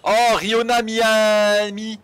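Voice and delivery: drawn-out, high and loud exclamation; excited cry. Real, not acted. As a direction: stretch it out in delight. Excited at finding the map spot. (exclamation ravie, aiguë et traînante)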